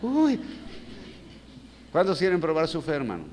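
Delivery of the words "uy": high, loud "oof" of reluctance; mock dismayed "uy". Preacher imitating the congregation's reluctance, high mock "uy". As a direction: let out a high mock-alarmed "uy". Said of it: "las caras que hicieron todos".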